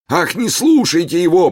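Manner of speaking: высоким громким жалобным голосом; застонать, будто от боли; притворный стон. Pretend. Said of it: "простонал Гуга". (притворный стон высоким громким голосом)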